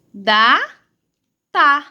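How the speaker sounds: two syllables with a long gap; sílabas separadas. Shown as a demonstration. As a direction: sound out data as DA-TA. Teacher sounds out possible word. (leitura silabada)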